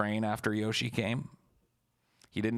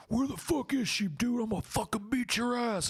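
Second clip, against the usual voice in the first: much higher squeaky threatening voice; mock angry friend. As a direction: squeak out the threat like a furious little guy. Yoshi threatening.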